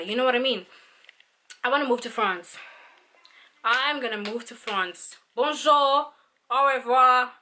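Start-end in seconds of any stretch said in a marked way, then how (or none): 5.4-7.3 mock French. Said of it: "I'm learning 'cause I'm going to France".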